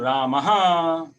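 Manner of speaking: रामः with a sharp breath pushed out at the end; breathy visarga. Shown as a demonstration. Teacher showing रामः with a forceful exhaled ending. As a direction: say रामः, forcing air out hard on the final ः. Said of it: "अब रामः बोलने से".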